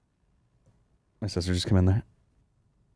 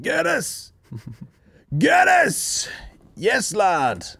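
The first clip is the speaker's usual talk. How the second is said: high-pitched mock holler across a hotel; squealed, drawn-out matey yell; retold calling out; 12 semitones higher, much slower than usual. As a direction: shout it like a cheeky lad hailing someone.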